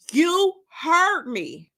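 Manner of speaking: slow menace. Acted. Destiny confronting Terry at the door.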